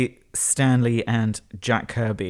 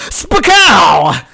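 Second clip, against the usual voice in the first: loud, high "spakow" blast; explosion noise; 8 semitones higher, 11 dB louder than usual. Pretend. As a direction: shout a comic-book sound effect. Imitates comic-book explosion.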